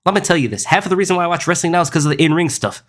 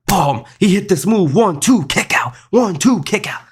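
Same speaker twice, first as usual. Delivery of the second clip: higher-pitched, punchy play-by-play imitation; mock match call; 5 semitones higher, slower than usual. Streamer parodying nonstop kick-out matches, mock match call. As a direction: count out the near-falls in a higher, mock-excited voice. Mimics frantic finisher-spam match calls.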